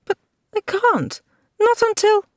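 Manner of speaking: high, pleading woman's voice, cut off; distressed woman. A narrator's reading. Lady refusing to leave before Fingal returns, high and pleading. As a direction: rise high and break off mid-plea.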